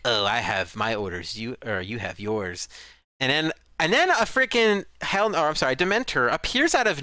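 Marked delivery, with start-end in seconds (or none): 0.0-2.7 low, slow, unhurried villain reply; imitated calm villain.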